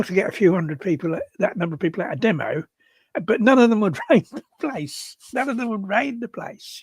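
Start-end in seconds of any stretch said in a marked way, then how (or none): 3.2-6.8 half-laughing, voice lifted high; amused. Real, not acted. Activist scoffing that today's demonstrators would never raid, amused. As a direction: say it through a real laugh. Amused at raiding being unthinkable.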